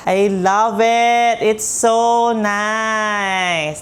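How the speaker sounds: gushing delight. Real, not acted. Gushing over new console.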